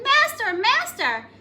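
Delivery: high, loud cry of master; harp calling out. Acted. Golden harp crying to its master as Jack steals it, high loud call. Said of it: "my harp is calling".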